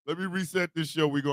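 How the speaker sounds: ordinary talk, nothing marked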